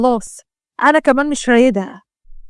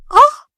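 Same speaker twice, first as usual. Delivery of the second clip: high, loud yelp after a slap; sharp cry of pain; 13 semitones higher, 6 dB louder, slower than usual. Acted. Israa slapped by her mother, sharp pained yelp.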